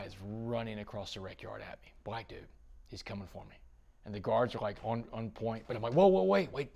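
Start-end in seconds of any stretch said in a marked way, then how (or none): 5.9-6.7 high, loud burst to halt someone; urgent plea. Retold or imitated. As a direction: call out urgently, reenacting the alarm. Retold plea to halt alarmed guards.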